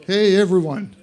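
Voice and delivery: ordinary delivery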